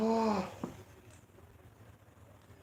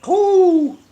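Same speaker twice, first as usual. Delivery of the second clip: high, loud yelp of pain; 11 dB louder than usual. Real, not acted. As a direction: cry out as the heat hits. Burning pain from hot pepper.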